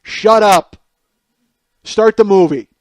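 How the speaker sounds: loud, dismissive yell; loud, impatient moviegoer; curt yell. Retold or imitated. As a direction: shout it without any concern. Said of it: "one guy just goes".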